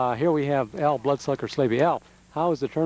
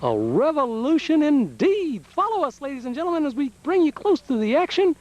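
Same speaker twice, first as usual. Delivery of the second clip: announcer voice; 12 semitones higher than usual. Acted. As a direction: open the broadcast like a big-event host. Announcer opening broadcast.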